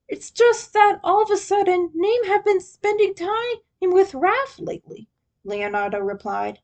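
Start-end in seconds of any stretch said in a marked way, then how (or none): 0.1-5.1 higher, lightly voiced worried leader; a hint of a sulking older brother; Leonardo's voice. A narrator's reading. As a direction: Leo confiding his jealousy to Mikey.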